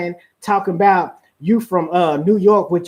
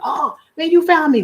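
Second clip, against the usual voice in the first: protesting ballplayer; 7 semitones higher than usual. Acted. Player calling foul on the playground, high mock protest. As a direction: object like a fouled player. Acted playground basketball quarrel.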